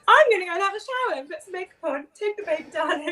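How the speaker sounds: high, loud, quick burst of eagerness; bright-eyed rush, retelling her own excitement; excited self-quote. Retold or imitated. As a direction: rattle off the plan like you can't wait. Retold morning plan with baby.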